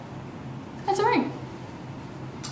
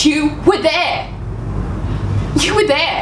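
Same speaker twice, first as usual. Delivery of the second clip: higher, slow and emphatic accusation; accusing cry; 6 semitones higher, slower than usual. Acted. To Jake Porter, raised accusing cry about her sister. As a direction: hurl the accusation at him.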